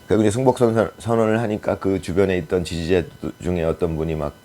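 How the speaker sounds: normal talking voice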